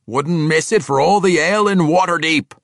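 loud, high dwarf howl; a dwarf roaring his eagerness. Acted. Athrogate telling Bruner he would not miss the fight, howling.

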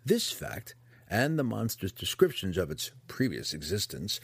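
ordinary delivery

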